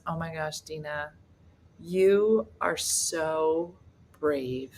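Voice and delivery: slow, drawn-out pitying compliment; faint praise. Retold or imitated. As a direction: softly stretch out the backhanded praise. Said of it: "He goes".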